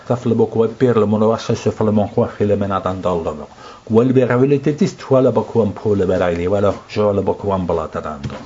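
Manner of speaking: fluent French-sounding glossolalia, flowing and unbroken; speaking in tongues. Shown as a demonstration. Preacher demonstrating tongues to the congregation, fluent glossolalia. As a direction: let nonsense syllables pour out like a real language. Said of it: "minä näytän teille nyt pienen pätkän kielellä puhumista".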